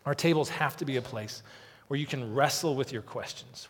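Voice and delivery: usual speaking voice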